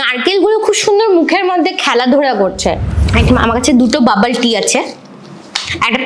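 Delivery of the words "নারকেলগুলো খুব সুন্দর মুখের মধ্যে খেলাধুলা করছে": talking between bites; chewing while talking. Real, not acted. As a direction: mouth partly full. Seen 0: Bit the snack earlier; chews with closed mouth, hand at lips.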